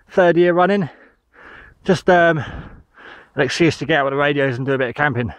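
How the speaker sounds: ordinary delivery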